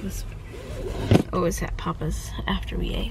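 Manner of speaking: whispered aside, no voice. Real, not acted. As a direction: whisper it so nobody nearby hears. Aside while filming in public.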